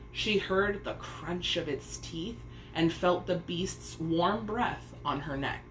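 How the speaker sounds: plain reading voice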